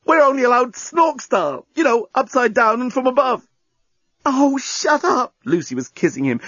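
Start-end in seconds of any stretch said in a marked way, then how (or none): 0.0-3.5 high-pitched, grinning delivery; excited explaining. A narrator's reading. Journalist grinning as he explains Blorontin rules, excited. 4.2-5.3 high, drawn-out moan; impassioned hushing. A narrator's reading. Lucy silences him with kisses.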